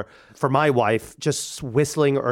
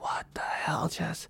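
whispered private aside; 3 semitones higher, 12 dB quieter, slower than usual. Retold or imitated. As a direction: hush it as if only to yourself. Inner reaction to strange family.

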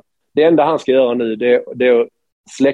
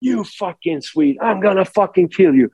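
higher pitched mock yell from afar; imitating an enraged rival screaming down the hallway; a hammed-up, half-shouted threat; 6 semitones higher than usual. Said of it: "hör han stå där och skrika".

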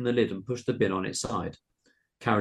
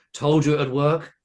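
higher, louder, proud boast; 6 semitones higher, 8 dB louder than usual. A narrator's reading. Girl to her classmates after the trick, proud. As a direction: say it loud and proud. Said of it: "said Emiliana Butterbean proudly".

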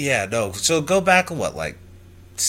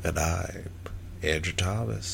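a bit less hushed, still soft; quiet; 4 semitones lower, 8 dB quieter than usual. Real, not acted. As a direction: repeat your name softly, slightly firmer. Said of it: "You're so quiet".

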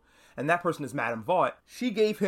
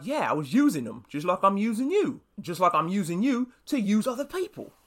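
put-on British accent, pitched higher as it goes; Butcher; 5 semitones higher than usual. Butcher admits to Huey he's using him, bad British accent. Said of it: "Sorry for my bad British accent".

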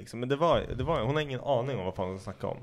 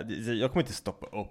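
ordinary talk, nothing marked

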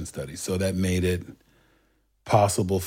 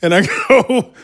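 higher voice, laughing through the punchline; 9 semitones higher, 6 dB louder than usual. Real, not acted. Laughing at his own punchline.

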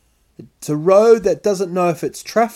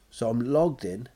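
usual speaking voice

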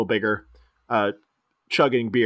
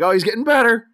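high, loud delight; excited cheer; 9 semitones higher, 5 dB louder, faster than usual. Acted. Host as Fink watching the chugging, excited cheer. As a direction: cry it out in mock amazement.

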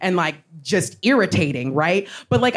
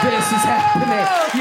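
much higher, slow, thrilled; gleeful bystander; 13 semitones higher, slower than usual. Retold or imitated. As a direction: savour the drama, drawn out and high. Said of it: "everyone was sitting there like".

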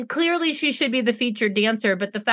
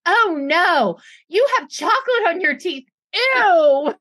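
louder, higher, grossed out; mock disgusted coach; 6 semitones higher, 5 dB louder, slower than usual. Coach telling Vivi her teeth are dirty, mock disgusted. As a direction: recoil at chocolate teeth. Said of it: "She goes".

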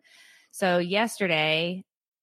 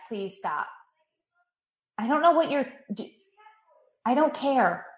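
irritated snap; 6 semitones higher than usual. Real, not acted. Snapping at intruder mid-podcast.

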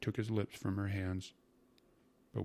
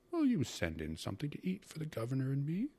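higher, lilting polite request; uncle's request; 7 semitones higher than usual. A narrator's reading. Uncle asks his sister to send in food, airy request. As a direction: call it through the door, rising at the end.

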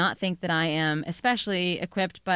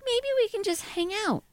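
much higher, lightly sweet, hopeful voice; sweet alien greeting; 13 semitones higher than usual. Retold or imitated. Greeting the arriving aliens in a sweet, high voice. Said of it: "I'm like".

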